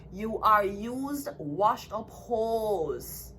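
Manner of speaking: very slow, each word dragged out; drawn-out insult, word by word; slow contempt. Real, not acted. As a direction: spell it out slowly with scorn. Cursing rivals after tarot reading.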